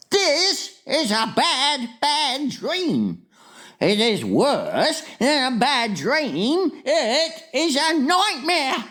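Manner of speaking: high, slow, ominous insistence; dramatic giant dreading his catch; horrified warning. A narrator's reading. Distressed giant describes a nightmare.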